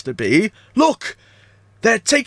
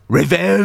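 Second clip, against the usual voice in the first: REVENGE lower and drawn right out; "revenge" said long, low and ominous; 4 semitones lower than usual. Acted. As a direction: stretch "revenge" into a dramatic threat. Ominous translation of ducks' threat.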